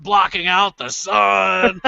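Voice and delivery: hammed-up, half-hearted scream; mock scream. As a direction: stretch it like bad game acting. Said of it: "the guy screaming about".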